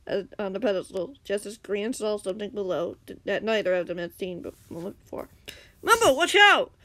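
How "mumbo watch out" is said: high, loud shout of alarm; warning cry. A narrator's reading. Green spots danger and warns Mumbo, crying out. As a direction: yell a warning to a friend. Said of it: "Green cried".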